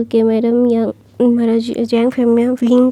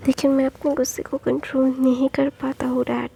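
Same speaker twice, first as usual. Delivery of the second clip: whisper; 3 dB quieter than usual. Acted. Son voiced in whisper.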